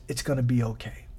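low, soft, quick reassurance; softly reassuring, low and quick; inside voice. Acted. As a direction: drop to a quiet inner voice. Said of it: "It's an inside voice that says".

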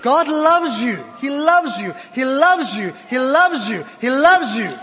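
high, loud, slow declaration; shouting praise. Acted. Pastor shouting to the congregation, fervent and loud.

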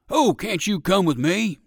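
eager, loud dog's plea; old circus dog voice. Acted. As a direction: call out hopefully as the old dog. Percival the circus dog speaks.

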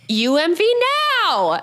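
high, loud call of the letters; imitated yelled acronym. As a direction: shout it across the parking lot. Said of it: "she's like".

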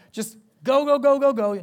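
go go go. Retold or imitated. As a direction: build the frenzy with every go. Frantic Sunday, no time to eat.

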